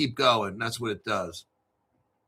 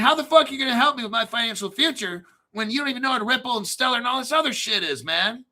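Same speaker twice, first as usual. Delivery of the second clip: fast, high-pitched exasperated rant; indignant challenge; 8 semitones higher, faster than usual. Retold or imitated. Customer challenges advisor who doesn't know Ripple, exasperated fast rant. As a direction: re-enact telling off someone useless.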